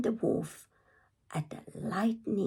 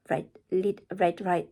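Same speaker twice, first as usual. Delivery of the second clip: usual speaking voice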